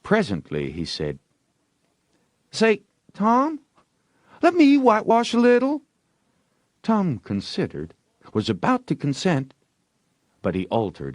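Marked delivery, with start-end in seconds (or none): 2.5-5.8 put-on high, fast pleading boy; breathless eager kid; eager Ben. A narrator's reading. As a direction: beg quickly, all excited.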